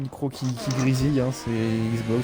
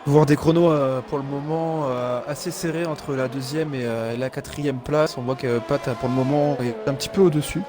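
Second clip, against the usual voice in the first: motorsport commentator; 4 semitones higher than usual. Acted. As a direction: lively race play-by-play. Live race play-by-play.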